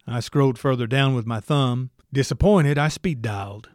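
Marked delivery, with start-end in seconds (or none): none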